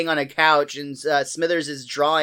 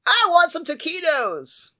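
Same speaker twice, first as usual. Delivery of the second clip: whiny passenger voice; 11 semitones higher than usual. Pretend. Voicing a passenger.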